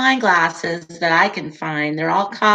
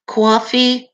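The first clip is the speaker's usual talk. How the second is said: New York coffee; 5 semitones higher, 4 dB louder than usual. Retold or imitated. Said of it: "she would always say coffee".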